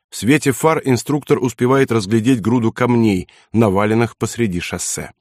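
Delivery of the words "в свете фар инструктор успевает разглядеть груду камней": ordinary delivery, nothing marked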